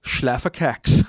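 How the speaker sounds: loud, slow German; mock German accent. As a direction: announce the translation in exaggerated German. Reading out German translation.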